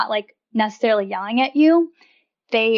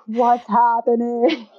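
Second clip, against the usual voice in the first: slow, dazed what's-going-on voice; baffled husband; much slower than usual. Retold or imitated. Husband startled awake by wife's complaint, slow baffled voice. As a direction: draw it out like he's lost. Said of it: "And he's like".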